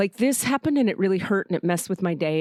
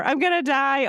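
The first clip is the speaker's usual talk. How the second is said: put-on scared child; 6 semitones higher than usual. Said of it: "And I'm five".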